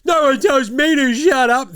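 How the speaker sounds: high, loud petulant mock of Draymond; hammed-up indignant toddler comeback; mock tantrum. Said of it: "And Draymond's going".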